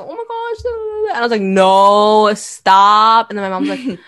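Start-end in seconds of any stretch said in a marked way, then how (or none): 0.0-1.1 high-pitched mocking imitation of a brother; mock know-it-all. Sister mimicking her scholar-acting brother, mock know-it-all. 1.4-3.3 loud, drawn-out objection; retold protest. Sister retelling her car quarrel with brother, loud protest.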